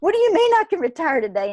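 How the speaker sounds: high, loud, rushed incredulous question; acted-out startled disbelief, blurted fast; retold scared disbelief. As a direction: re-enact the shocked comeback, pitch way up. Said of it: "It scared the shit out of me".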